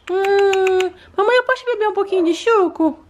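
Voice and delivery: put-on high childlike voice of a small daughter; little girl voice. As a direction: play the sick little girl talking to mom. Daughter doll asks for juice.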